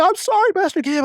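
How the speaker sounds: very high panicked apology; mock frantic, pitch way up; imitated freaking out. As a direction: squeal it like someone freaking out. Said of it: "she was freaking out".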